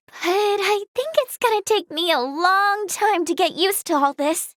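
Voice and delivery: ordinary talk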